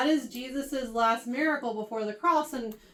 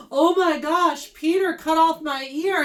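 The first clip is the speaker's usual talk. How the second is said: louder mock complaint; hammed-up cry of outrage; whining servant; 3 semitones higher, 7 dB louder than usual. Servant complaining about Peter.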